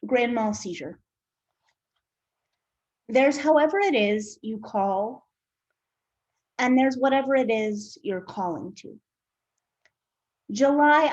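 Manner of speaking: measured recital, pauses between phrases; poem reading. Acted. Poet reads an imagined patient's poem, measured recital. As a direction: set out each line deliberately, letting silences sit.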